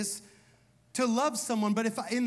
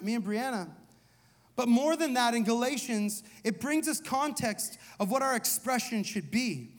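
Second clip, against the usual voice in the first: ordinary delivery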